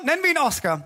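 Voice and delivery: ordinary talk, nothing marked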